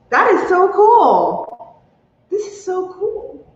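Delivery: high, slow, choked-up delight; touched. Real, not acted. Streamer marvelling at a gift, deeply touched. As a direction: marvel slowly, real emotion pitching the voice up. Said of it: "I'm really, I'm really touched".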